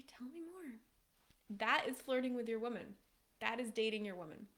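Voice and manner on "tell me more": higher, much softer, gentle tell me more; soft invitation. Shown as a demonstration. Coach showing calm reply despite agitation, soft and high. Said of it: "just say".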